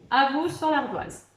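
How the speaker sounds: usual speaking voice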